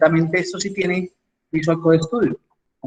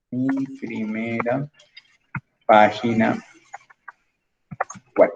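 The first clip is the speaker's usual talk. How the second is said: slow dictation; 4 semitones lower, slower than usual. Shown as a demonstration. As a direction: say it slowly while you type it out. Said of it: "vamos a decirle acá".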